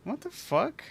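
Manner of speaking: high, baffled what-the; stunned outburst. Real, not acted. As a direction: caught completely off guard. Baffled by rude answer.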